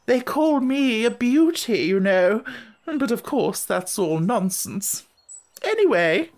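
gushing grand lady. A narrator's reading. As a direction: coo over the baby like a grand lady. Lady character speaks.

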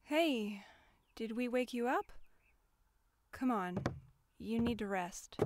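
hushed, low and tender; gentle soothing. Acted. Kyoshi to someone they woke up, gently hushed. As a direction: settle them back to rest softly.

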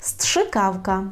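"strzykawka" stretched out syllable by syllable; very slow, hard word. Shown as a demonstration. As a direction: spell out the hard word slowly. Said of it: "це те важке слово".

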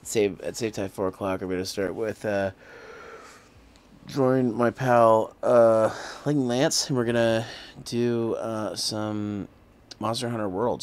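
loud, fast hype for the stream; excited announcement. Real, not acted. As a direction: pump up the crowd. Hyped plug for tomorrow's collab.

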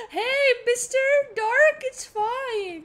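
high, loud, slow taunt to the dark; teasing call to the dark; mock soothing call. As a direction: coax the darkness like a pet, high and loud. Addresses the darkness.

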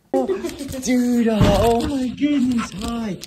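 sing-song high cooing to the dog; high pet voice. Real, not acted. Owner greeting his dog Duda back from the groomer in a high cooing pet voice.

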